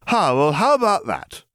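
lordly approval, drawn out; slow, high grand drug lord. Acted. Lily bluffing atmosphere.

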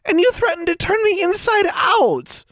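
very high, outraged squeal; squealing accusation. A narrator's reading. Tomo accuses Alexandra of threatening her, squealing. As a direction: squeal the charge at them. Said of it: "Tomo squealed, pointing at Anna accusingly".

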